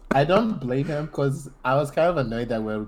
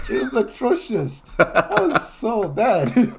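laughing, high and slow; amused disbelief at a friend's awful accent; 8 semitones higher, slower than usual. Real, not acted. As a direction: giggle the verdict out, high.

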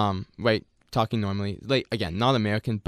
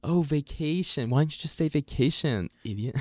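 mock American officer; 5 semitones higher than usual. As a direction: drag it out to mock the traveller. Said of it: "And then it's like".

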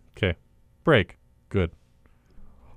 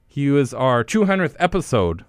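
usual speaking voice

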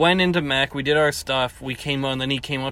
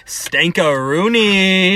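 put-on slow, stretched-out drunk greeting, pitched higher; drunk guy imitation; 5 semitones higher, 5 dB louder, much slower than usual. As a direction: drag out the word like a grinning drunk. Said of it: "and he was like".

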